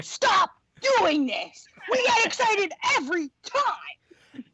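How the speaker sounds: high, strained mock shouting; exasperated family. Family fooled by plant-baby announcement, exasperated mock shout. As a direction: yell it slowly in comic exasperation.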